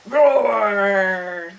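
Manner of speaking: long, drawn-out lion roar. Shown as a demonstration. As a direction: growl out the word as a roar. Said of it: "instead of roaring".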